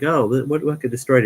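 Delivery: mock panicked recruit; alarmed question. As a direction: blurt it out in alarm. Recruit asks what burned.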